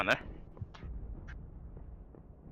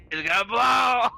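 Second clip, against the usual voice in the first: louder, higher, slow laughing shout; 10 semitones higher, 10 dB louder, slower than usual. Real, not acted. GM laughing as Bessie nears explosion, loud and slow. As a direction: blurt it out through real laughter.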